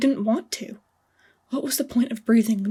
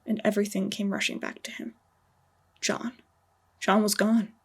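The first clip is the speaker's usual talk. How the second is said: usual speaking voice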